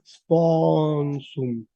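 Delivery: "Sponsum" with a long, wide open O; slow "Sponsum", lingering on the open O. Shown as a demonstration. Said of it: "es una O abierta".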